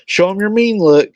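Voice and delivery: put-on high, far-off parent voice coaxing a kid; mock parent voice. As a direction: play the parent egging a child on. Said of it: "how parents will tell kids".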